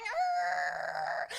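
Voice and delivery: low grunting growl like a beast; hammed-up teen grunt; mock animal grunt. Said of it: "start walking school halls going".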